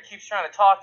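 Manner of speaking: normal talking voice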